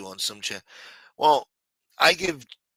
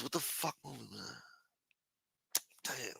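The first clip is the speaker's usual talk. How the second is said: muttering; 17 dB quieter than usual. Real, not acted. Muttering to himself, forgetting.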